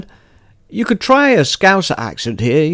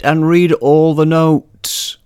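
ordinary delivery

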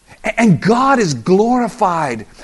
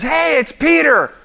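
excited; 10 semitones higher, 4 dB louder, slower than usual. Retold or imitated. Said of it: "someone says".

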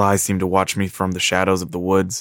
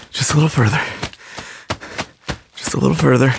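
out of breath; 6 semitones higher, faster than usual. Acted. Exhausted sprint out of forest.

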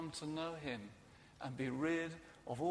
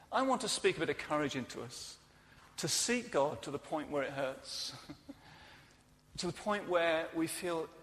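ordinary delivery

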